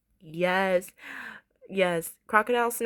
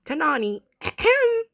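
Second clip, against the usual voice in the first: high, louder mock summons; pointed throat-clear; 6 semitones higher, 5 dB louder than usual. Dima summoning Nani for a definition, pointed ahem. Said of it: "Dima literally says".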